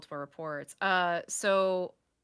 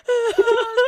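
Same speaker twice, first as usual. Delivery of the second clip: ordinary talk, nothing marked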